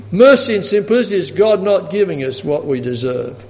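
ordinary talk

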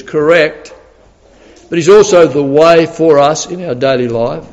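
ordinary delivery

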